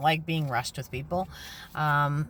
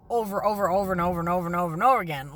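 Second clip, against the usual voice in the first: rapid rattle; much faster than usual. Real, not acted. Exasperated rant about repeated lives.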